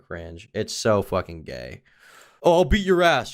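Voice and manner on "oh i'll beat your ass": higher, louder blustering boast; mock tough-guy threat. Mocking guys who act tough, blustering threat. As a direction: puff it up like a macho poser.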